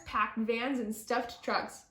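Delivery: ordinary delivery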